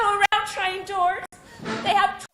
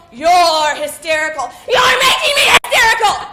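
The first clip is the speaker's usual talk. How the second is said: loud, rising crying yell; hysterical shout; 8 dB louder than usual. Acted. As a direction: snap back at him, tears in the voice.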